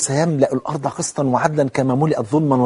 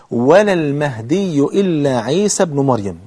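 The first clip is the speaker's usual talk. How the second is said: slow, weighty final clause; deliberate formal Arabic with long vowels; repeated hadith recitation; slower than usual. Shown as a demonstration. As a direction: stretch out the conclusion of the recitation. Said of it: "تعيده تاني".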